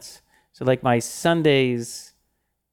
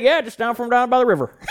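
put-on Southern accent; 10 semitones higher than usual. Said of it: "He's like".

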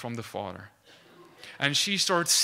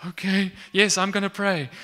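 higher and louder, a jittery burst of yes; nervous eager yes; 5 semitones higher, 7 dB louder than usual. Retold or imitated. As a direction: blurt it out nervously, loud and high. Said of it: "Even in her fear in that moment".

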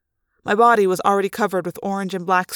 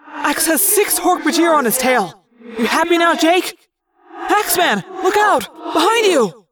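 shouting from above; 9 semitones higher, 6 dB louder than usual. Acted. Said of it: "Tobias called down from above".

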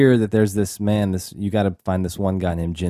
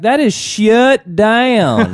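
much higher, louder, very slow exaggerated Southern drawl on "That is shut down"; bad Southern accent; 12 semitones higher, 7 dB louder, much slower than usual. Cohost mocks the actress's bad Southern dialect, high and slow. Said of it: "horrible dialect".

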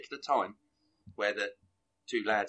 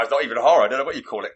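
ordinary talk, nothing marked

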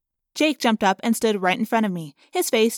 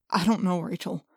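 deflated; 2 semitones lower than usual. A narrator's reading. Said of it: "Suddenly, Jake just sagged".

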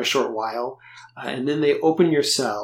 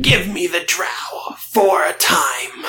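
strained, forced rasping whisper-shout, slow; croaky smoker's voice; 6 semitones higher, 5 dB louder, slower than usual. Acted. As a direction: push a wrecked voice through damaged lungs. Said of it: "force your voice through".

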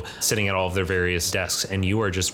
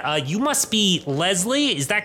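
dwarf professor greets Leslie, hammed-up cheerful; eager greeting; 10 semitones higher than usual. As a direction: greet the new student brightly.

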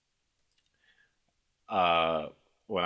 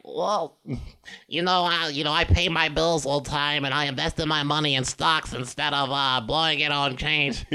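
pitched-up silly voice; higher, put-on mocking voice; weird voice; 5 semitones higher than usual. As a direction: make reasonable words sound dumb. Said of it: "if you say it in that voice".

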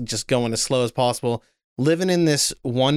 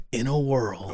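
movie trailer voice; 4 dB quieter than usual. Pretend. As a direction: drag out in a world, epic. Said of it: "Trailer guy voice".

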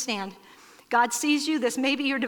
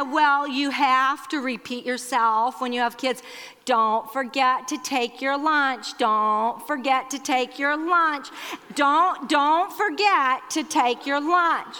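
slow, drawn-out deliberate repetition; Barb; slower than usual. Retold or imitated. Sister repeating herself as to kids, slow and deliberate. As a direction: repeat it slowly, leaning harder each time. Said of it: "Barb does talk like this".

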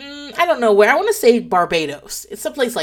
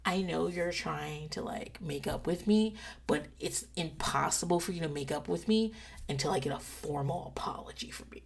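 soft stern terms; 4 semitones lower, 13 dB quieter than usual. Retold or imitated. Telling husband her terms.

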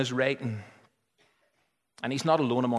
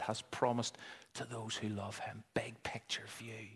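soft breathy whisper at the end; hushed whisper; 9 dB quieter than usual. Real, not acted. Preacher whispering the promise to his listeners, hushed. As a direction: let the promise fall to a whisper.

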